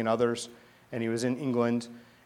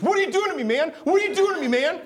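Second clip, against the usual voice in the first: much higher and louder, desperate cry; shaken plea; 5 dB louder, faster than usual. Retold or imitated. Punk leader cries out to the praying pastor, high and shaken. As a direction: cry it out like he can't stop shaking. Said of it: "he's shaking".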